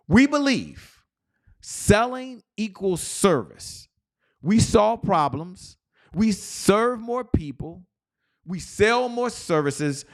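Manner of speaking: slow, high-pitched rallying declaration; belief-statement declamation. Shown as a demonstration. Coach recites the sales belief statement to the huddle, slow rallying declamation. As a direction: proclaim it phrase by phrase, high and slow. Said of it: "let's talk about our belief statement".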